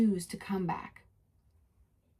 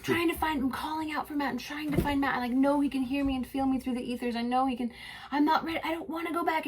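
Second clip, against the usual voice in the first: high-pitched, searching and frightened; a small voice calling for Matt in the dark; desperate pleading; 6 semitones higher, faster than usual. Retold or imitated. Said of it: "I'm like".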